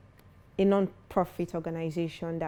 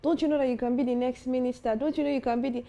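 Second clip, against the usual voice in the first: higher-pitched mock dad, pushing and insistent; a hint of a proud father demanding more; imitating father's pep talk; 6 semitones higher, 3 dB louder, faster than usual. Father urging his son to believe in himself, lightly mimicked stern voice. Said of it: "he'll be like".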